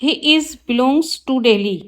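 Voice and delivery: slow, careful read of the wrong sentence; slow teacher dictation. Shown as a demonstration. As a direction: spell it out word by word for the class. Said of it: "wrong है sentence".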